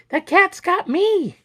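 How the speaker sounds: high, drawn-out falsetto wail; shrieking. A narrator's reading. Rosie grabbed by the cat, drawn-out shriek. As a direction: shriek it slowly in comic panic. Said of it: "shrieked Rosie".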